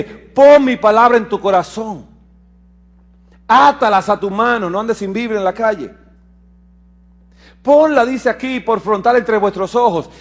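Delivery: loud, high scripture declamation; lectura de las Escrituras. Acted. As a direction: proclaim the verse to the congregation. (lectura bíblica proclamada con fuerza)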